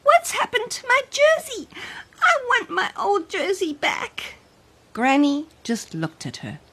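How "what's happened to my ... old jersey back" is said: put-on high, stammering child voice; confused little girl. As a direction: stumble over the words in disbelief. Child upset after wailing.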